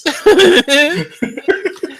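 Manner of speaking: a big high burst of laughter. Real, not acted. Laughing after absurd stream.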